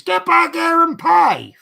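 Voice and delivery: high, loud mock yell at a passenger; retold shout. Passenger retelling the driver yelling at her, mock shout. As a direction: bark the order, hammed up. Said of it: "He shouted at me".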